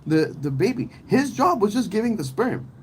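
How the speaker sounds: outraged high-pitched rant; heated retort. Retold or imitated. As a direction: talk her down, pitch way up. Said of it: "I'm like".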